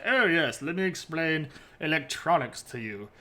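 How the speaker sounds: a hammed-up German accent, loud on the opening; mock German professor showing off, German accent; deliberately bad German accent. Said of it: "that was a bad German accent".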